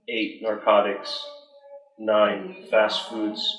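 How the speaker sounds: normal talking voice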